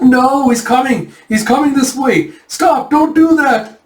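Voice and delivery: high, loud, drawn-out pleading; hammed-up rising terror, stretched words; mock panic. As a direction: plead as the threat closes in. Taejun terrified as Sinha approaches.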